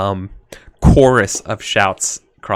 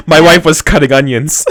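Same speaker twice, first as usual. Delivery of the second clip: loud, high, through laughter; laughing excuse; 6 semitones higher, 9 dB louder than usual. Acted. Player excuses the character's tears, laughing loudly. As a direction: blurt out a jokey excuse, laughing.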